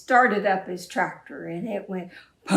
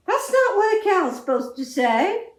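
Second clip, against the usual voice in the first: usual speaking voice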